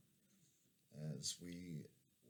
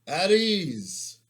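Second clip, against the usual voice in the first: loud barked command, pitched up; called out; 7 semitones higher, 9 dB louder than usual. Acted. Sergeant calling the men to attention, loud shout. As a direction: snap the troops to attention. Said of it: "Sergeant Priet called out".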